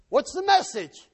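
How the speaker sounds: loud, high, slow demand; demanding king. Acted. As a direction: call for the report like a king. King demands the messenger's news.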